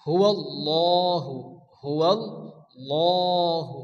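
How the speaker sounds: হুয়াল্লাহু very slowly with a dark, rounded "law"; full heavy lam. Shown as a demonstration. Teacher showing how Allah's lam is read, slow full হুয়াল্লাহু. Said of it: "ল পড়তে হবে".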